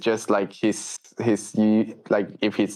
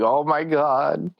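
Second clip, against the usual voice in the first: mock nervous muttering to yourself, pitched up; paranoid; 5 semitones higher than usual. Worker carrying cash salary to the bank, playfully paranoid. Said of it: "All paranoid, you know?".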